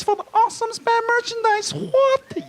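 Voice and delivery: very high, squealing mock glee; hammed-up squeal over a great prize; mock giddy excitement. Mock glee over spam merchandise.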